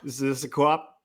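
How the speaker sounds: lightly put-on Boston accent; a hint of a Boston tough guy asking. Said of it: "The Boston accent?".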